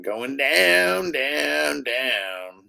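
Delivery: long, sliding "down, down, down" like a slow descent; playful drawn-out descent. Pretend.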